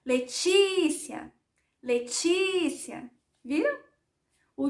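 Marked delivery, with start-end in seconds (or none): 0.1-1.3 "Letícia" called higher and slow, long TÍ; drawn-out "Letícia" with the stress held on "tí"; calling out. Shown as a demonstration. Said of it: "fossem chamar o meu nome". 1.8-3.1 "Letícia" called slowly with a long TÍ; slow singsong call of "Letícia". Shown as a demonstration. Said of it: "fossem chamar o meu nome".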